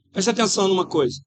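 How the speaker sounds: usual speaking voice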